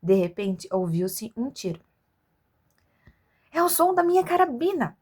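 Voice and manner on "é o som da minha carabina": excited shout of alarm; shouted line. Acted. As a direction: call out in alarm. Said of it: "gritou o caçador".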